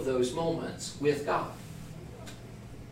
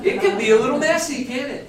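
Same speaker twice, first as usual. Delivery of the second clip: laughing; 11 semitones higher, 7 dB louder than usual. Real, not acted. Joking about messy hospitality.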